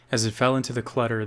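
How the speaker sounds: ordinary delivery, nothing marked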